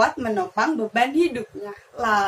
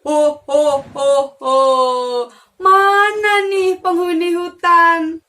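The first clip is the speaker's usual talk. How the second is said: menacing wolf; 3 semitones higher, 6 dB louder than usual. Acted. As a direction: boom out hunting for prey. Wolf looks for prey.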